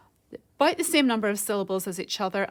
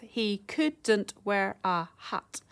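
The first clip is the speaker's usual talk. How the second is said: every syllable slow and separate; half-speed, evenly spaced beats; counting syllables; much slower than usual. Shown as a demonstration. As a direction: sound it out slowly, syllable by syllable. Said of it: "So if you count the syllables".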